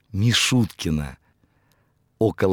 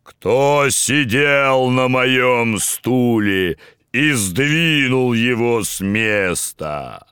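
scary growl; slower than usual. Acted. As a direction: growl it like a huge bear. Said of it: "зарычал страшным голосом".

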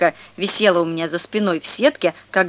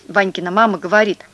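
ordinary delivery, nothing marked

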